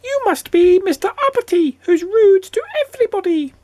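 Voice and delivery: very high little creature voice; goblin. A narrator's reading. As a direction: tease in a squeaky goblin voice. Goblin identifies rude Mister Uppity.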